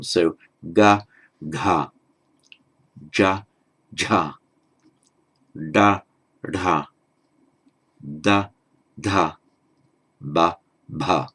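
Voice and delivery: ga then gha with a breathy release; voiced aspirates. Shown as a demonstration. Teacher demonstrating voiced aspirates, low and careful. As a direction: pair each sound with its aspirated twin. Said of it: "voiced consonants with the aspiration".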